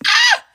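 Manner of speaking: sudden high yelp of pain; short high ouch cry; sharp cry of pain. Real, not acted. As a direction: yelp as it stings. Hurts herself.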